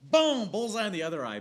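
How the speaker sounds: loud, high thrilled play-by-play; loud, high excited retelling. Real, not acted. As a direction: burst out with glee.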